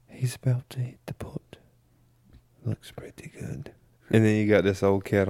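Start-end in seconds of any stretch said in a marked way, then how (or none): 0.1-3.7 whispered, hushed golf commentary; golf announcer whisper. Pretend. Mocking English golf announcers, hushed whispered commentary. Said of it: "announcing the golf tournament".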